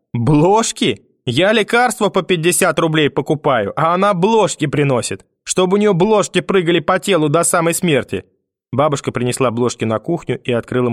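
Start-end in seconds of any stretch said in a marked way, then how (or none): 0.1-8.2 high-pitched indignant grandmother rant; outraged grandmother. A narrator's reading. Grandmother rages at mother's present to the boy, outraged. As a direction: rail at the useless gift.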